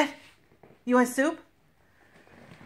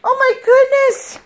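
high, loud fussing; playful mock worry; 7 semitones higher, 4 dB louder than usual. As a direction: fuss over him like a parent. Mock worry over toy.

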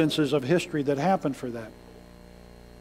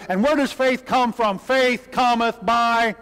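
ordinary delivery

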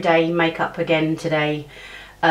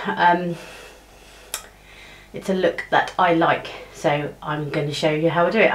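usual speaking voice

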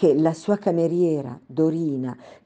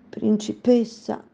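holding back tears; 6 semitones higher, slower than usual. Acted. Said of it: "trattenendo a stento le lacrime".

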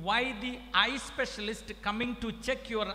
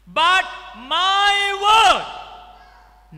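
slow proclaiming shout; 10 semitones higher, 17 dB louder, much slower than usual. Real, not acted. Proclaiming God's word.

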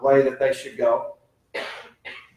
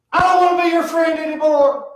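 put-on high, loud whiny kid yelling; child snapping at his dad; angry child's shout; 12 semitones higher, 4 dB louder than usual. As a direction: shout it up high like a sulking little boy. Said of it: "he looks at me and he says".

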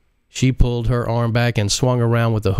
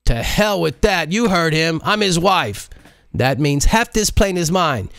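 higher defiant voice; Modise defiant; 9 semitones higher than usual. Acted. Modise to her assistant, defiantly claiming the plane.

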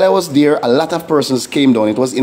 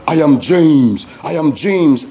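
retold demon voice; slower than usual. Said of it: "And then I heard".

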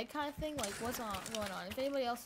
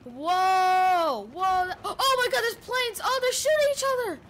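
much higher and louder, shouting in wonder; amazed shouting; 6 semitones higher, 11 dB louder, slower than usual. Real, not acted. Gamer seeing planes dogfight, loud amazed shouting. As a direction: yell in delight at the sky. Sees planes fighting overhead.

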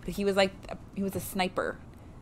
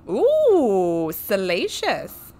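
mock scandalised; 3 semitones higher, 9 dB louder, much slower than usual. Reacts to a scandalous detail.

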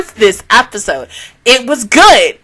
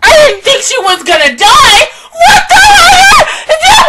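high, loud, half-crying shout of shock; a real cry of disbelief at the screen; anguished shout; 12 semitones higher, 5 dB louder than usual. Real, not acted. Reacting to a character's death, crying out in shock.

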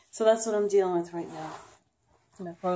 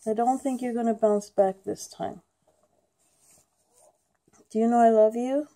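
pet talk; 6 semitones higher, 5 dB louder than usual. Real, not acted. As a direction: coo to a sick pet, pitched up. Owner cooing to her sick dog.

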